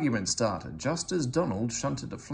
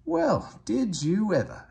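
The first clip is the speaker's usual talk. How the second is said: much higher, louder astonished cry; amazed exclamation; 11 semitones higher, 6 dB louder than usual. A narrator's reading. Old engines marvel at a mountain engine, astonished exclamation. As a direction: gasp out the wonder as both engines. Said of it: "exclaimed the two old engines".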